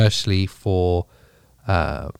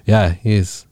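normal talking voice

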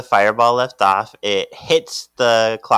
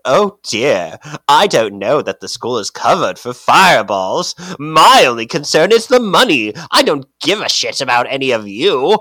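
louder mock-fussy professor voice; hammed-up professor; greedy professor; 4 semitones higher, 6 dB louder than usual. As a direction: ham up a stingy headmaster who only cares about money. Mocking professor's greedy speech.

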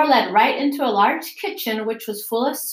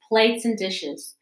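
ordinary delivery

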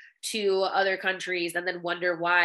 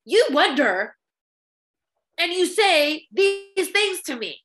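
scolding mom; 6 semitones higher, 4 dB louder than usual. Retold or imitated. Son scolding his blunt mom, exasperated and rising. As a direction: tell mom off with growing exasperation. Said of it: "tell my mom shit like that".

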